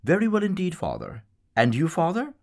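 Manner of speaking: high, loud society lady's voice; lady greeting the priest in the street, high and loud; politician's wife. Acted. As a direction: answer the priest as a gushing well-to-do lady, pitched high. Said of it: "the wife of Mister David Sheehy".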